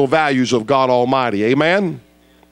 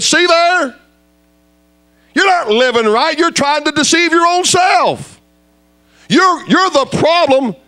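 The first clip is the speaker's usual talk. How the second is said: retold rebuke; 12 semitones higher than usual. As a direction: lay into him like a pulpit rebuke.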